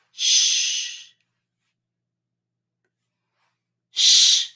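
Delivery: hushing "sh" with no voice; a long "Sh" hush; isolated sh sound. Shown as a demonstration. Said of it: "I want the sound".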